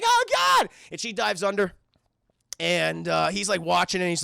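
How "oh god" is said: very high loud cry; mock terrified cry. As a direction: shriek as trapped. Cover closing on her.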